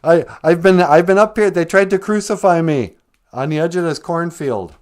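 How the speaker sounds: loud, high, worked-up complaint; indignant scarecrow. Acted. Scarecrow complaining about being hung up, loud indignation.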